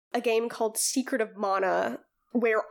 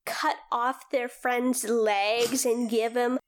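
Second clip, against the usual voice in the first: normal talking voice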